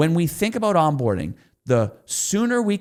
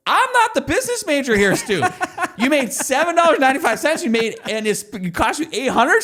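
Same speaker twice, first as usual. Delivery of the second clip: a much higher, louder, half-laughing imitation of the wife; mock the wife pointing out the losing math; exasperated wife; 11 semitones higher, 3 dB louder than usual. Husband imitating his wife doubting the business, high and exasperated.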